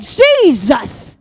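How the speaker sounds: loud drawn-out shout; shouted cry. Acted. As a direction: cry out at full preaching force. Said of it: "He shouted".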